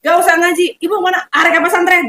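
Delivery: put-on rushing the kids off; loud announcement. Teacher telling pupils she is off to the pesantren, loud.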